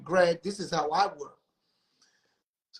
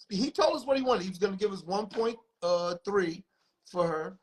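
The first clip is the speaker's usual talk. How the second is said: usual speaking voice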